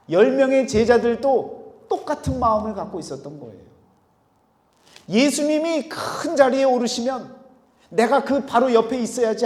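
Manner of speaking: forceful preaching, pitched high; impassioned preaching. Real, not acted. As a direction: drive the point home loudly. Preacher builds at full force.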